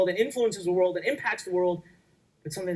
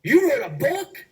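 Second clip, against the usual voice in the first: high, slow astonished question; stunned disbelief; 10 semitones higher, 7 dB louder, slower than usual. Retold or imitated. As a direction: act amazed that he wrote a book. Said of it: "this is the reaction".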